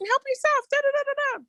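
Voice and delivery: high, breezy imitation of a man; breezy brother-in-law voice. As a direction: toss it off casually, high-pitched. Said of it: "and he was like".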